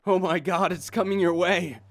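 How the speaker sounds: high, loud frantic cry; terrified warning. Acted. Prisoner warning the others, terrified. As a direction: scream the warning.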